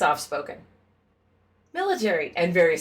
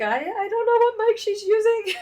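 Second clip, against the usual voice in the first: high quoted voice. Retold or imitated. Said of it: "and I'm like".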